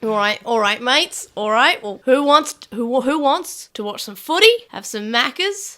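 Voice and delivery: loud hammed-up ocker; rally the mates in broad Aussie; put-on Australian accent. Australian offering mates footy and Maccas, exaggerated Australian accent. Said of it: "putting on Australian accent".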